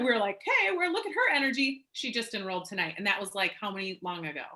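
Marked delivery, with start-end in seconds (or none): 0.4-1.8 high-pitched impressed exclamation; mock team amazement, pitched up; retold excited reaction. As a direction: voice lifts high as if marvelling at someone.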